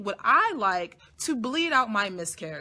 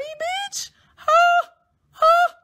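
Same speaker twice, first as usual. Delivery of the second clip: very high, louder squealing huh; incredulous squeal; 11 dB louder, much slower than usual. Real, not acted. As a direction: shriek in mock disbelief. Mocking the receptionist incredulously.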